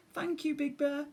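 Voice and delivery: high small timid voice; small scared bear cub. Acted. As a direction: speak as a frightened little cub.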